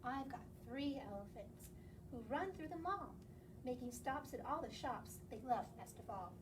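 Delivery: plain reading voice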